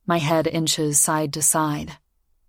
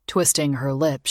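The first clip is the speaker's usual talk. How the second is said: usual speaking voice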